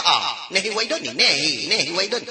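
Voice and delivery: lightly voiced Indian accent, singsong; a hint of a comic Indian voice, playful; mock Indian accent.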